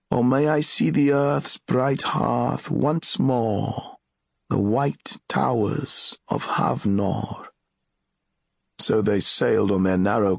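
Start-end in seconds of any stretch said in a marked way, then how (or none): 0.1-7.5 slow, higher-pitched heroic recitation; reciting hero's words. Acted. Friend replies with the hero's words, slowly recited. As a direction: intone it slowly, like lines from an old epic. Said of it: "spoke the hero's words from the deed of Ereth Akbe".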